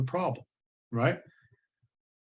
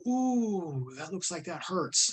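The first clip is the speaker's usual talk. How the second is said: mock understatement; 4 semitones higher, much slower than usual. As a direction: play it dry like it's nothing. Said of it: "and so I go".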